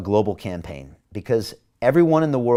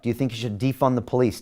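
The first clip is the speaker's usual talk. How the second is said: ordinary delivery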